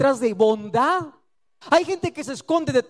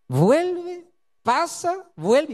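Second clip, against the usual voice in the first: lightly voiced child; 6 semitones higher, much slower than usual. Daughter passing back and forth.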